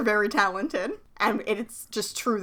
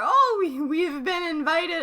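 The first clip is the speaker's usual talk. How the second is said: a higher, slow put-on foreign accent, half-remembered; vague Countess accent; 6 semitones higher, slower than usual. Cohost voicing Minx announcing the invitation, vague foreign accent. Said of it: "I don't remember what her fucking accent is".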